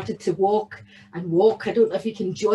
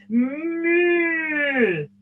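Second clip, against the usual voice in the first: middle-sized moo; 5 semitones higher than usual. Acted. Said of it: "a middle-sized moo".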